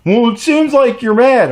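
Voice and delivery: high, loud put-on nagging; loud mock needling in a high voice; mocking woman's voice. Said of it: "she kept at it".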